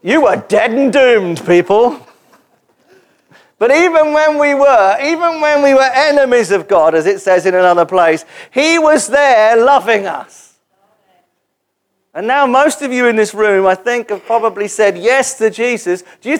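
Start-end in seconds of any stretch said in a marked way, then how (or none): 0.0-10.5 impassioned preaching, slowed and raised. Acted. Preacher stirring the church to feel loved. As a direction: preach it with weight.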